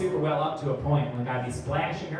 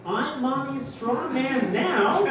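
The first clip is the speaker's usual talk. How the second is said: slow, lightly raised puzzled cop; mock confused cop; 7 semitones higher than usual. Comic imagining confused cops at the murder scene, slow puzzled. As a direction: drag it out like a baffled detective. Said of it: "just fucking like".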